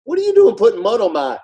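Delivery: high, quick indignant protest; indignant retort. Retold or imitated. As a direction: play the startled blind man, affronted. Blind man's indignant retort.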